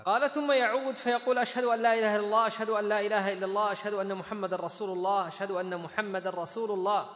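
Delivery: loud, high recitation of a narration; reading out text. A narrator's reading. Teacher reads Abu Mahdhura's hadith to students, loud formal reading.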